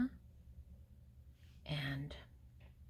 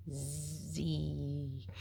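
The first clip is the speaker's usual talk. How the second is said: sleepy snore sound; 4 semitones lower, 5 dB quieter than usual. Acted. Gorilla snoring.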